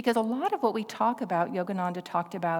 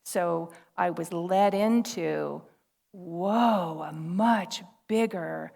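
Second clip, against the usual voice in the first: ordinary delivery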